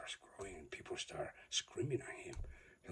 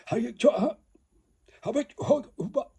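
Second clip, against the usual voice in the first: mock foreign greeting; 17 dB louder, faster than usual. Imitating fighters' formal exchange.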